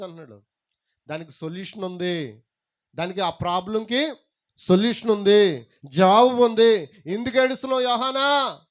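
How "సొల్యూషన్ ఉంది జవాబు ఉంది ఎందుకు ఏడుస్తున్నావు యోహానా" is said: loud, drawn-out, rising preaching; full-force preaching. Acted. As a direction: proclaim it, stretching out the words. (గట్టిగా సాగదీస్తూ ప్రసంగిస్తూ)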